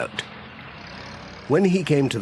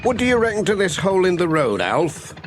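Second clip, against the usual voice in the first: a lightly raised, brisk Pat voice; quick and chatty, a little higher; 8 semitones higher, faster than usual. Acted. Postman asking farmer about the hole, lightly voiced and brisk.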